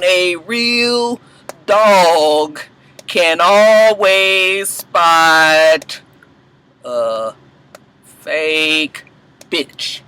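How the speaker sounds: word by word, drawn out with long pauses; broken down slowly. Shown as a demonstration. Vlogger breaks down a great one-liner, very slow word by word. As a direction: savor every word, stretching "always" and stopping before "fake" and "bitch". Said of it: "I had to write that down".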